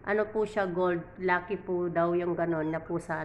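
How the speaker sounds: ordinary talk, nothing marked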